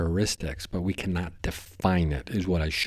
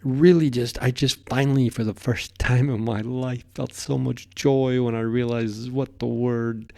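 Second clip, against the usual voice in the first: overjoyed; 5 semitones higher than usual. Real, not acted. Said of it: "I'm crying right now practically".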